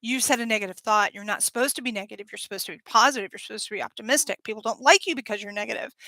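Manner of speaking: fast, nagging judgmental voice; a quick-fire scold, mildly voiced; rapid scolding inner critic. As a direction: rattle off the critic's rules. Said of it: "that judgment of saying".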